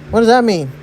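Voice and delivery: loud, high, startled question; taken aback. Acted. Daughter hearing her mother blamed, taken aback.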